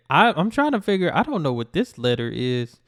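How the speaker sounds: ordinary delivery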